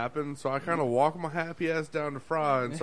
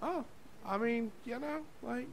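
awkward squeaky reply; 10 semitones higher, 8 dB quieter than usual. Retold or imitated. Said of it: "I'm like".

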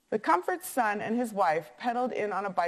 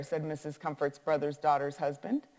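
usual speaking voice

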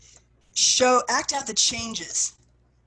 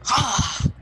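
a sudden loud, high gasp of feeling; loud high cry of ah; emotional ah; 4 semitones higher, 6 dB louder than usual. Shown as a demonstration. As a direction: let the music swell into an ah. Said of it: "you're gonna now feel".

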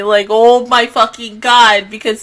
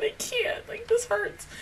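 much softer, higher broken whisper; real hushed whisper; 12 semitones higher, 14 dB quieter than usual. Real, not acted. Viewer whispering, hurt by the episode. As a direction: barely get it out. Overwhelmed emotion.

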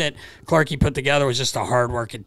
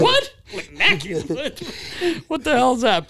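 mock disbelief; 10 semitones higher than usual. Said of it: "You know".

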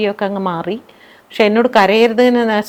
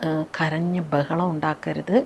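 softer, hushed confiding tone; softly, in private; 4 semitones lower, 8 dB quieter than usual. Retold or imitated. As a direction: lean in and tell it privately, softly. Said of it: "പതുക്കെ എൻ്റെ സ്വകാര്യത്തിൽ പറഞ്ഞു".

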